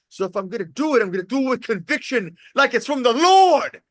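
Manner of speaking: retold rousing conviction. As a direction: build from firm to a high, loud shout. Host rousingly retells guest's resolve.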